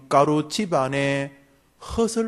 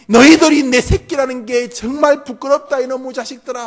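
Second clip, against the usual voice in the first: acted father's tirade, building to high, loud shouting; 야곱, furious yelling; 7 semitones higher, 8 dB louder than usual. Father scolding his sons for bringing ruin, angry shouting. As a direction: rage louder and higher as you scold your sons. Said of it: "막 버럭버럭 야곱이 소리를 질렀을 때에".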